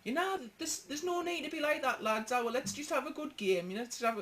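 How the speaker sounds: sweetly reasonable, lads, let's all calm down; a cheeky overly polite peacemaker; mock-polite calm. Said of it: "we just turn around and go".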